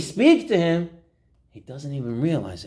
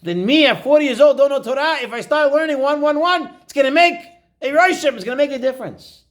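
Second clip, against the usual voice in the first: high, loud, building conviction; excited realization; 11 semitones higher, 5 dB louder than usual. Acted. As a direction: exclaim a life-changing discovery. Rabbi Akiva's excited realization acted out.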